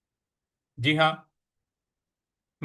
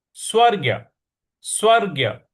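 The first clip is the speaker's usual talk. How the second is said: स्वर्ग्य with weight on ग; stress demo; 4 semitones higher, 3 dB louder than usual. Shown as a demonstration. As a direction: lean on the ग in स्वर्ग्य. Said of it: "इस प्रकार से बोलेंगे".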